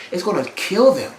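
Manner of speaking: ordinary talk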